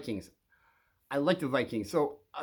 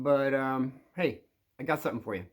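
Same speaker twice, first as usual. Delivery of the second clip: ordinary talk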